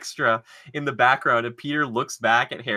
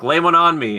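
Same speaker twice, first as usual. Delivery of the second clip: louder, higher mock movie quote; mock Harry line; 5 semitones higher, 6 dB louder than usual. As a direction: ham up the kiss request. Harry voiced in retold scene.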